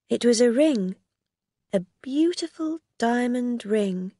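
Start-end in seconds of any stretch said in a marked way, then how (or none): none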